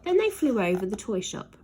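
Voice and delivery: ordinary delivery, nothing marked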